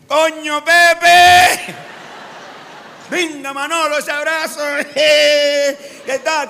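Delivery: high, drawn-out joyful hail; effusive Canarian greeting. Retold or imitated. Islanders greeting each other with a hug, effusive calling. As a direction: hail a friend loudly with stretched vowels. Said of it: "¿Cómo nos saludamos nosotros? De otra manera".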